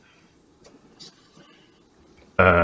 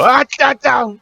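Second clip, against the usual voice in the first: high goofy grunting noise; silly noises; 4 dB louder than usual. Retold or imitated. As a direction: make dopey wrestler noises. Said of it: "And then you go".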